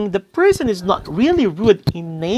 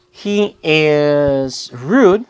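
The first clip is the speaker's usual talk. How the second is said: "He is rude" drawn out very slowly, RUDE falling; slow example; 2 semitones lower, much slower than usual. Shown as a demonstration. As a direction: spell out "He is rude" slowly for the class. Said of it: "so it's a fact".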